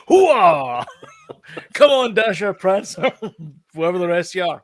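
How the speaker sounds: loud laughing gung-ho soldier; rally the reindeer like a drill sergeant; Al Pacino. Retold or imitated. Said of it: "Al Pacino now?".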